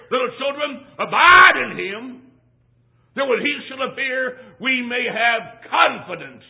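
high, loud preacher's cry; full-force preaching. Real, not acted. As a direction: cry out the scripture, drawing out key words.

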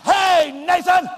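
shouting a name across the room; wrong way to call a talking child; sharp shout. Shown as a demonstration. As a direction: bark the boy's name loud and high. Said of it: "I'm not gonna say".

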